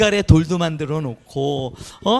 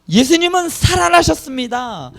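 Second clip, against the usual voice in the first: high, ringing declaration of faith; lifted-voice Easter proclamation; proclaimed confession; 9 semitones higher, 5 dB louder than usual. Acted. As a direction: proclaim it to the congregation, raised and loud. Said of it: "이렇게 고백하는 겁니다".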